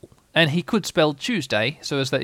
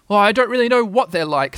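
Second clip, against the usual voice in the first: higher, louder owl voice; frank admission; 7 semitones higher, 6 dB louder than usual. A narrator's reading. Owl admits ignorance to Rabbit, frankly. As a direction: own up at last. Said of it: "said Al frankly".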